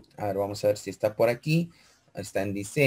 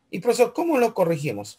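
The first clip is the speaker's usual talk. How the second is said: higher and fast, lightly voiced pupil; student asking; 7 semitones higher, faster than usual. Teacher acting a pupil asking how to fix it, higher and quick. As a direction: rush the question like an eager student.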